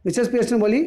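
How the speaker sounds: normal talking voice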